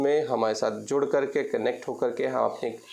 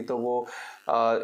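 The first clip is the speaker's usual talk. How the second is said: normal talking voice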